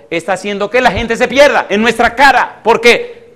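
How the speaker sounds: loud, high preaching outburst; impassioned denunciation. Real, not acted. Preacher decrying sport Christianity to the congregation, impassioned.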